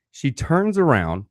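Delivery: ordinary delivery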